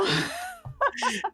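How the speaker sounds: high laugh. Real, not acted. Listener laughs.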